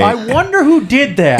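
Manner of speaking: mock wondering. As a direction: wonder aloud with hammed-up innocence. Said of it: "Taiwan's gonna be like".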